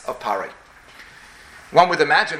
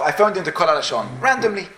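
ordinary talk, nothing marked

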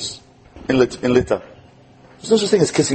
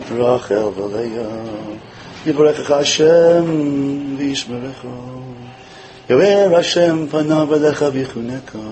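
slow, drawn-out, heartfelt chant; slow tender blessing over a child; loving blessing; slower than usual. Shown as a demonstration. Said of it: "I did this".

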